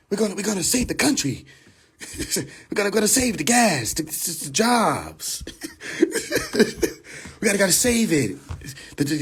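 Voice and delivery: lightly voiced Biden parody, trailing off; mock Biden speech. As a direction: stumble through the promises like a fading old politician. Mocking Biden's campaign promises.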